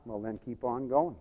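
low, soft urging; quiet exhortation. Real, not acted. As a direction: urge them on gently, voice well down. Soft take before shouted repeat.

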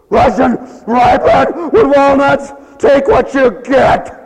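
high, loud, slow shout; hammed-up fed-up shout; mock angry volunteer. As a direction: bark it at the picky customer. Angry volunteer snaps at customer.

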